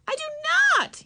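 put-on girl yelling a denial; yelled. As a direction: shout her denial. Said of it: "yelled Ramona".

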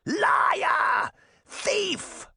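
extremely high witch shriek. A narrator's reading. Witch branding Sabrina a thief, shrieking. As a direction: shriek each word as an accusation. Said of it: "Baba Yaga shrieked".

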